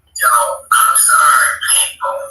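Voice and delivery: loud, exasperated shout of sorry; frustrated shout. Real, not acted. Shouting a fed-up apology to his viewers. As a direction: blurt the apology out at full voice.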